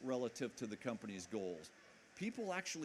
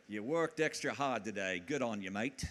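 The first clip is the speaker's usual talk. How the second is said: put-on a fair Australian accent, a touch louder; 3 semitones lower, 4 dB louder than usual. Boss praising a worn-out employee, Australian accent. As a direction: imitate a Kiwi boss's Australian-style praise. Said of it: "That's an Australian accent".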